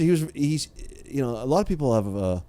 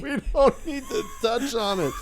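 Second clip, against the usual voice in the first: mocking echo; 13 semitones higher than usual. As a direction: parrot it back mockingly, way up high. Mockingly echoes cohost over his boast.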